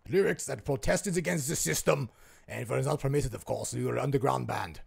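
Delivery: mock German accent telling the band's story. Imitating a Rammstein member telling of his punk band in a German accent. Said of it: "me doing a German accent".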